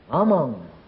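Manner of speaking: ordinary talk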